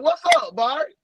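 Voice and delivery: sudden high-pitched hello; excited high greeting. Real, not acted. Greeting his friend Barry in the stream, squealing.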